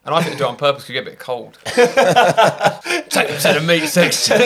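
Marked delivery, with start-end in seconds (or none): 1.7-4.5 slow, high, laughing through the joke. Real, not acted. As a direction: crack up at your own punchline. Cracks up at his own joke.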